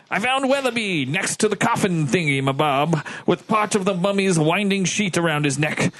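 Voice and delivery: formal officer giving testimony; a hint of stiff naval officer; lightly voiced ship's lieutenant. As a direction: read it out as a lightly acted witness statement.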